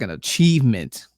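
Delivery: usual speaking voice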